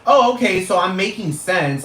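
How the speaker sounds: sharp, high mock realization; sarcastic oh okay. Real, not acted. Ranting at online critics, high sarcastic oh okay. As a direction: throw it back at them with high sarcasm.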